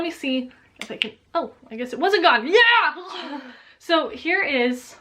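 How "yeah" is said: high, bright yelp of delight; excited yelp. Real, not acted. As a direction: squeal at the find. Excited find of product.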